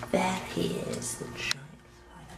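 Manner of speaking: slow creeping whisper; hushed whisper. Acted. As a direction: sneak up softly on the fly. Stalking fly, hushed.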